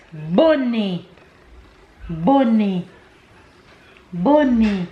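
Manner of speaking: bonnet said the French way, "bo-NAY", silent t; French "bonnet" ending in a long falling vowel; teaching French word. Shown as a demonstration. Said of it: "but in French it's".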